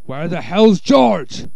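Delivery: loud, slow bark; angry shout. Acted. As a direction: demand it loudly. Boss shouting.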